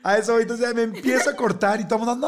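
normal talking voice

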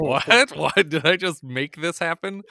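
hammed-up surprise; mock bewilderment. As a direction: throw up your hands in disbelief. Viewer reacting to movie.

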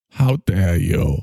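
low, slow disdainful 'How dare you?'; Greta's frozen-scowl voice, low and slow; Greta Thimble. Acted. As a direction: drop low and drag it out with disdain. Greta repeats her line.

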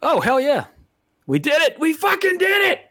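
high-pitched celebrating, building to a shout; triumphant cheer. Real, not acted. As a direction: crow over finishing, pitch climbing. Celebrating finishing the episode.

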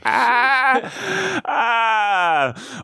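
long falsetto "ah"; high mock wail. Host caps the old song references, mock wail. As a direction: hold a comic high cry.

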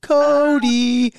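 high, loud call of the name; sing-song call up high; calling Cody. Retold or imitated. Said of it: "all I heard was".

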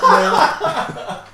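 high laughing now; chuckling start. Real, not acted. Laughing after reading note.

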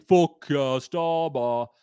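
slow, drawn-out Geordie chant; Geordie accent. Shown as a demonstration. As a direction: chant it in broad Geordie, stretching the vowels. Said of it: "in a Geordie accent".

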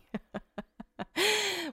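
high, soft giggling fit; real laughter. Real, not acted. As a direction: laugh helplessly at your own slip. Laughs at own wrong example.

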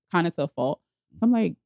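ordinary talk

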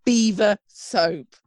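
slow, deliberate "Beaver soap"; deliberate repeat. Shown as a demonstration. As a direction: savour each word of "Beaver soap" slowly. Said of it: "gonna say it one more time".

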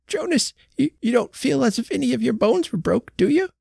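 on the edge of tears, pleading; nearly sobbing old woman. A narrator's reading. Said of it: "Her voice was almost sobbing".

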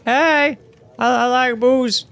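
high, slow mock-innocent voice; high-pitched, dragged-out hint; booze-loving friend. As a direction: play a friend hinting, drawn out and high. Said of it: "someone in your life that's like".

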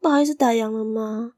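a poor mother's timid question at closing; timid, hesitant. Acted. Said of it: "怯生生说". (怯生生地小声问)

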